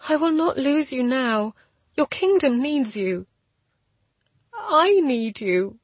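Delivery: high, urgent plea; emotional, high-pitched insistence; desperate pleading. A narrator's reading. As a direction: plead with him to stay. Pleading with the dying man.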